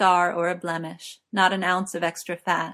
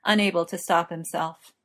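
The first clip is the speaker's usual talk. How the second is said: usual speaking voice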